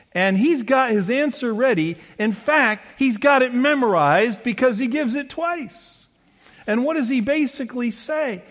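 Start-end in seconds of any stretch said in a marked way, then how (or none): none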